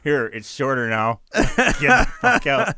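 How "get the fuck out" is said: higher, louder, slow, laughing; real laughing get out; laughing disbelief. Real, not acted.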